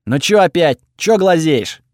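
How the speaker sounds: lightly acted gruff annoyance at being stared at; irritated character retort. A narrator's reading. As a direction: push the questions up and out, annoyed. Gottfred snaps at being stared at.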